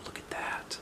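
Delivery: hushed whisper. Real, not acted. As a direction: whisper it like a secret. Whispered aside about picture.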